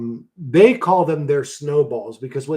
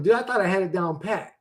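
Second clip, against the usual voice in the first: ordinary talk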